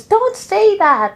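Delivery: high, slow, playful reprimand; mock scolding mom. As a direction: scold him lightly, stretching it out.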